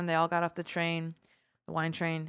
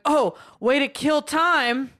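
loud, drawn-out heckle, pitched up; heckler; 7 semitones higher, 7 dB louder, much slower than usual. Retold or imitated. Drunk guy on a winery tour interrupting the guide, mock heckle. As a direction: imitate a heckler calling out slowly and loudly. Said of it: "I remember getting heckled like that".

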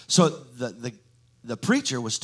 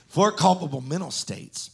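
"four culpable mental states" louder and higher, stress on "four"; emphatic; 6 semitones higher, 7 dB louder than usual. Real, not acted. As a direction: drive "four" home to a drowsy crowd. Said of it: "Are you with me?".

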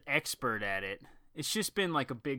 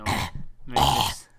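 low rasping fake cough voice; mock throat hack; 12 semitones lower, slower than usual. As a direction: hack it into the mic. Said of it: "Hack it into the microphone".

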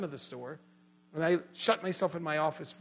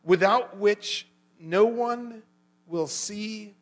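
slow, emphatic verse reading with long pauses; weighty, drawn-out pulpit reading; scripture reading; 3 semitones higher, 6 dB louder, much slower than usual. Real, not acted. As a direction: proclaim each phrase deliberately. Reads a Bible verse aloud.

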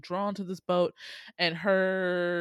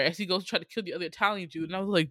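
ordinary talk

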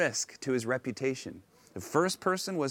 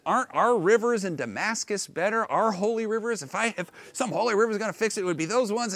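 offended; 7 semitones higher than usual. Retold or imitated. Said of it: "just completely offended".

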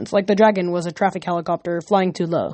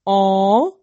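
drawn-out, rising whine of letdown; disappointed; 4 dB louder than usual. A narrator's reading. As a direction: whine like a let-down simpleton. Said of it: "Cal looked so disappointed".

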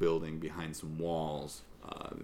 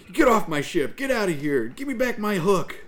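high, loud furious yell; angry shouting; 13 semitones higher, 7 dB louder than usual. Acted. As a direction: yell him off the ship. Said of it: "drops his pirate accent".

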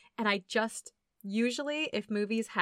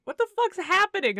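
bewildered; 9 semitones higher, 5 dB louder than usual. Retold or imitated. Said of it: "This poor daughter is just like".

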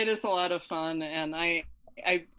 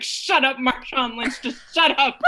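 mock yell, a little louder and pushed; retold exasperated shout; 3 semitones higher, 4 dB louder than usual. Detective finally cuts off a witness who keeps talking, mock exasperated shout. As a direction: half-shout it as an imitation of her losing patience. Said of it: "shouts at him".

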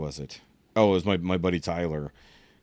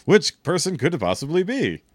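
mock-innocent sarcastic wondering; 6 semitones higher, 4 dB louder than usual. Real, not acted. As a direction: act like you have no idea. Teasing guess about who.

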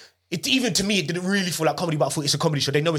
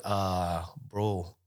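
put-on low, soft stab at a Bajan accent; attempted Bajan accent; 9 semitones lower, 8 dB quieter than usual. Comic imitating friend at the door, half-hearted Bajan accent. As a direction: try a Bajan accent, quietly and badly. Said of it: "I'm gonna try and do a Bayesian accent".

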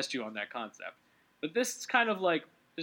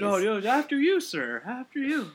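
high, hammed-up courtesy; mock politeness; 8 semitones higher, 5 dB louder than usual. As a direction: insist with exaggerated, high-pitched deference. Hammed-up mutual politeness as joke.

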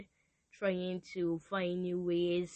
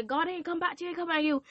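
high mocking voice of God, not answering; teasing high voice for God; 9 semitones higher, much faster than usual. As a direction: lift the pitch high to mimic God brushing you off.

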